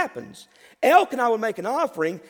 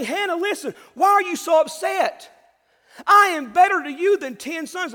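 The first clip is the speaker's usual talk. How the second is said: higher and louder, pressing the point; insistent husband's shout; 7 semitones higher, 5 dB louder than usual. Acted. Husband Elkanah urging Hannah, a loud shout. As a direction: call out to her as a pleading husband. Preacher voices Elkanah again.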